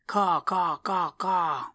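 loud high crow caws; cawing crow call. Acted. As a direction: caw out like a crow. Crow calling out.

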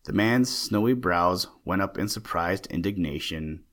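ordinary delivery

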